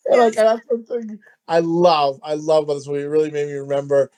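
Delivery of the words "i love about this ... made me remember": usual speaking voice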